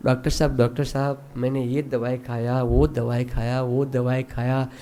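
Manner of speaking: low, soft litany of complaint; repetitive complaining patient. Acted. Patient repeats his medicine history to the doctor, quietly plaintive. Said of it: "बार बार घुमा घुमा के बोल रहा है".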